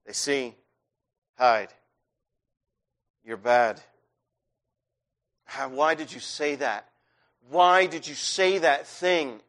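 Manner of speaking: starts hushed and low, builds to firm accusation; a nagging voice in the head, growing; lightly voiced inner critic, from hushed to pressing; accusing inner voice. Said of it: "what it says is".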